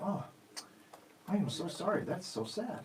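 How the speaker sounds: put-on soft, hushed comforting of a sad child; quietly empathize, like hugging your kid; gentle sympathy. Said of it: "You go".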